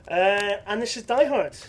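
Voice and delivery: self-satisfied. Acted. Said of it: "is to not sound smug".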